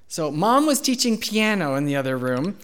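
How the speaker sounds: ordinary talk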